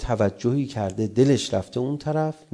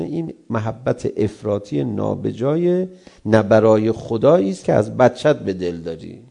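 normal talking voice

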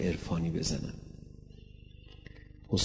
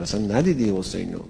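quick repeat; faster than usual. Shown as a demonstration. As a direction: rattle off اصلاً ندیدی حسینو fast. Preacher repeats the point quickly.

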